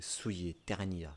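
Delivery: normal talking voice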